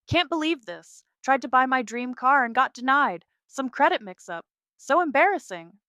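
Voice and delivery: loud, high, furious ranting. Acted. Sister ranting online about her denied car, furious and loud. Marissa's furious Instagram rant.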